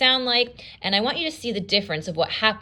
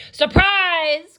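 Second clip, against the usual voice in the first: higher, louder gleeful cry; spring it on her with glee; wolf cries; 10 semitones higher, 6 dB louder than usual. Acted. The wolf bursts in on Granny with a loud cry. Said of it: "cried the wolf".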